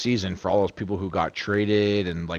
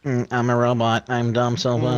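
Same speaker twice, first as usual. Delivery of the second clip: nerd voice; 5 semitones higher, 5 dB louder than usual. Acted. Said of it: "chirping the nerd voice".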